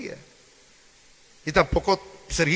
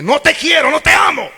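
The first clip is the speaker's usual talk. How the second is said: high, loud mock declaration; pretend rejection shout; 11 semitones higher, 9 dB louder than usual. Preacher voices someone saying they don't love you, as a loud high mock outburst. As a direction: fling it at someone hurtfully.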